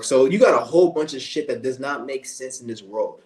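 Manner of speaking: ordinary talk, nothing marked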